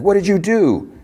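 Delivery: high-pitched retold yell, little added volume; mock yell. Solo to Juliet at the locked door, mock yell. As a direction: imitate his yelled accusation, pitch shot up. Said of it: "And he yells".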